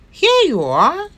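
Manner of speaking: high, loud delighted cry; cried out. A narrator's reading. Missus Duck finding Bear hiding, calling out gladly. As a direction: cry out, pleased to find him. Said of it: "cried Missus Duck".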